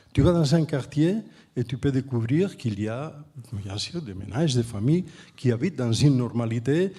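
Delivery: French with a Catalan speaker's accent; non-native French, from across the Pyrenees; foreign accent in French. Real, not acted. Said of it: "excusez-moi mon français".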